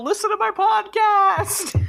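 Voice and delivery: high, slow mock shout; mock yell. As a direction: yell it across the court. Joke yell at the game.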